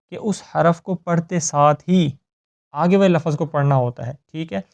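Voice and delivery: normal talking voice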